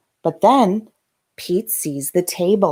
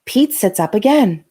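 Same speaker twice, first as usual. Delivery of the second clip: ordinary delivery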